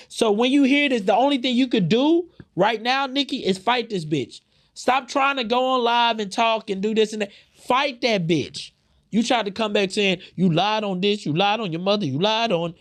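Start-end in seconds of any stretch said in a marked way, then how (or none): 0.1-10.8 high and loud, fired-up scolding; heated rant. Real, not acted. Telling Nicki to fight instead of going live, loud angry rant. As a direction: yell at her to just fight it out.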